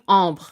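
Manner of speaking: ambre with a nasal first vowel and light "bre"; French ambre, not English amber; whole French word. Shown as a demonstration. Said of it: "when you say the whole thing".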